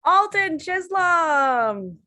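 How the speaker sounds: slow host shout-out of the comic's name; announcer call. Acted. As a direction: introduce the act like an emcee.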